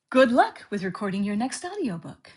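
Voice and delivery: ordinary talk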